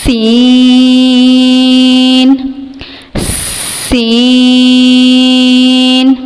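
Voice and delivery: سین held long with a whistling hiss; drawn-out whistling letter. Shown as a demonstration. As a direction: stretch سین and let it whistle. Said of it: "یہ حروف صفیریہ میں سے ہے". (سین لمبا، سیٹی کے ساتھ)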